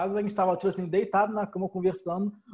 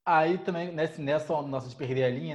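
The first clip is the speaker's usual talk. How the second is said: usual speaking voice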